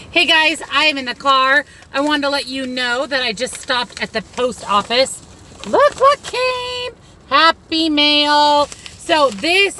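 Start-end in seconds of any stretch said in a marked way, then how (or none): 5.7-9.8 drawn-out, high sing-song squeal of delight; giddy, loud, high-pitched announcement; excited reveal. Real, not acted. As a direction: show off a surprise, high and stretched. Excitedly shows off surprise mail.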